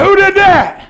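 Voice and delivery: high, loud indignant yell; mock angry shout. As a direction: yell like someone scratched your car. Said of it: "You'd be like".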